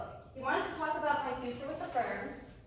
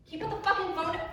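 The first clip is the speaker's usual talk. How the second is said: angry demand; 5 semitones higher, 5 dB louder than usual. Acted. Shouting at him to hang up.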